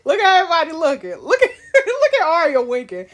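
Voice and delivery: loud, high, fast laughing delight; cracking up. Real, not acted. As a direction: laugh through it, can't believe it. Laughing at empresses' reactions.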